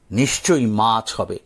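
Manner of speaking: plain reading voice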